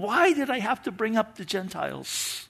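soft, anguished self-questioning; self-reproach. Acted. Paul in the barracks blaming himself, softly self-reproachful. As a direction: softly beat yourself up over it. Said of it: "probably beating himself up right now".

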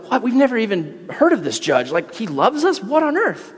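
mock confused prisoners; baffled convicts. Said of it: "the convicts are like".

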